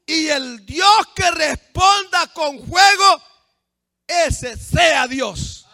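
high, loud, drawn-out proclamation; thundering preacher's cry; full-force preaching. Acted. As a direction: proclaim it slowly to a whole crowd.

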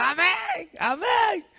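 very high-pitched amen cries; high mock cheering; mock empty cheering. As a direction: imitate hollow congregational cries. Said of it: "solo son gritos".

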